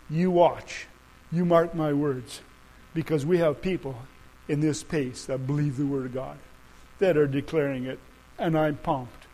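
low, soft, earnest declaration; hushed preaching. Real, not acted. Preacher telling believers he sees the day coming, low and hushed. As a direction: drop low and let each phrase settle.

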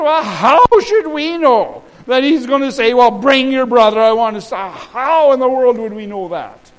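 high, loud, incredulous protest; acted indignant defense. Sons defending themselves to their father, acted indignant defense. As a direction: throw the blame off loudly.

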